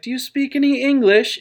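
high-pitched mock nun question; tentative nun. Nun to a man mumbling in German, tentative. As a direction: ask high, as the puzzled sister.

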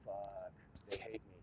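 ordinary delivery

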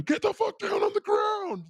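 very high mock police scream; imitated screaming. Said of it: "they fucking start screaming at him".

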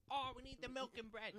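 high, whiny mock panic; mocking panicked shopper. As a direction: imitate a frantic shopper.

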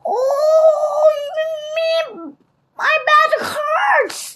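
high, drawn-out wail of pain; Stinky crying out. A narrator's reading. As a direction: moan loudly and slowly about the ache. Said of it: "Stinky cried".

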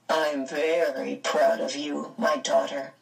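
a father's solemn pride; grave father. A narrator's reading. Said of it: "kissed her gravely".

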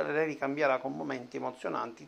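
ordinary delivery

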